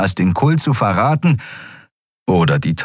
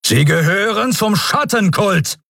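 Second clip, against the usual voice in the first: accusing; 12 semitones higher than usual. Acted. Admiral accuses the captive.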